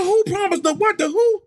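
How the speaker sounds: very high, flabbergasted questions; retold incredulity. Hearing about money and bottles promised, incredulous. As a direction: stack the questions in mock disbelief. Acts out disbelieving reaction.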